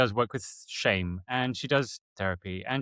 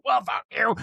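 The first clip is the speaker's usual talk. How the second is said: mock retort; 10 semitones higher, 4 dB louder than usual. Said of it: "automatic response is not".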